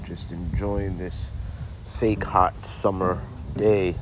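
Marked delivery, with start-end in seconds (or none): none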